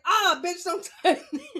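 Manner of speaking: high, loud laughing mock yell; retold childhood outburst, yelling with laughter. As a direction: lash out at her, half laughing. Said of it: "I was like".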